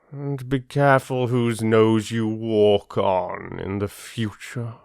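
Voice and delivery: a lightly voiced lion, slow and unhurried. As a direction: play the lion sparing the mouse, lightly done. Lion speaks to Mouse.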